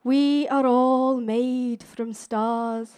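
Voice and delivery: slow, hushed dreamy impression; dreamy accent, very slow; dreamy Brian Cox. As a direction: breathe it out like a swooning TV scientist. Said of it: "in that dreamy accent".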